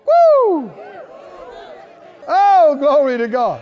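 high loud whoop of praise; ecstatic whoop. Real, not acted. Preacher rejoicing before congregation, ecstatic whoop. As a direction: let out a joyful shout.

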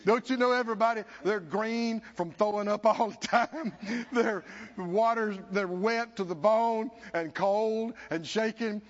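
usual speaking voice

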